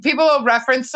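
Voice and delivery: usual speaking voice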